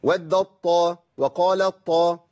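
ودت and قال said slowly, the ت merged into ط; measured demonstration of the merge; slow tajweed demo. Shown as a demonstration. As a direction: read it out slowly as a model. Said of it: "أقول إيه؟".

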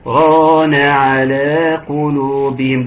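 ران على قلوبهم recited slowly after a brief stop on بل; tajweed pause. Shown as a demonstration. Teacher demonstrating the saktah to students, slow recitation. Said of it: "ده سكت".

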